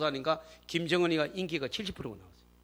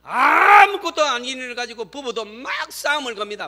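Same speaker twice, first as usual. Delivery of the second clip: animated exaggeration; 8 semitones higher, 8 dB louder than usual. Real, not acted. Lively picture of couples quarrelling.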